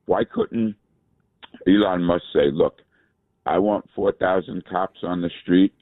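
ordinary delivery

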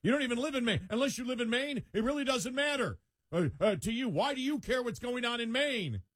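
high whiny pundit voice; high nagging mockery; mocking quote. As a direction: mimic the pundit high and scornful. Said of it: "somebody national saying".

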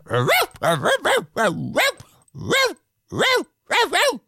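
little one-eared dog yapping; small dog barking. A narrator's reading. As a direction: bark like a tiny excited dog.

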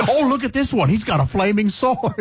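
high, giggly voice; laughing. Acted. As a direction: laugh the words out. Joking about Irwin in heaven.

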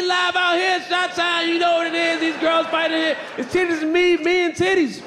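shouting it out like a hype man. Acted. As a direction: fire up the room. Hyped crowd call-out opener.

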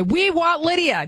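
playful pretend listener demand; mock demand. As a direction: chant it like eager fans. Voices listeners' demand to stations.

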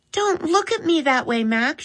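a sister brushing off a look; girl pleading lightly with her brother; Molly's girl voice. A narrator's reading. Molly answers Max's frown.

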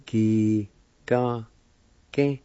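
"Ki, ka, ke" as three separate syllables; broken-up teaching take of "Ki, ka, ke"; syllable-by-syllable demo. Shown as a demonstration. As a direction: sound out "Ki, ka, ke" with pauses. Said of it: "First part is pronounced like this".

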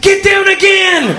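high, drawn-out, very loud yell; hammed-up warning scream; action-hero shout.

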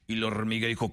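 ordinary talk

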